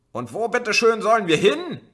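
very high, very loud screech. A narrator's reading. Woman in the crowd screeching at the police. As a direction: screech it in panic. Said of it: "kreischte eine Frau".

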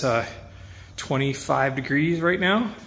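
ordinary talk

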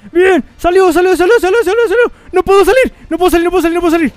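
high, loud thrilled yelling; excited shouting. Real, not acted. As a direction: shout with rising excitement and then alarm. Trap works then stuck.

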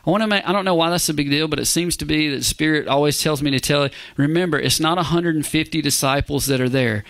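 ordinary talk

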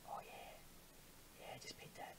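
very soft, low seductive murmur; mock-sultry whisper. Player flirting with the face being painted, mock-sultry whisper. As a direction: whisper it like pretend pillow talk.

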